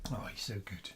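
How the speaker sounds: soft whispered praise; whispered fond praise for the puppy; tender whisper. Real, not acted. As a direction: breathe it out fondly over the dog. Fussing over the dog.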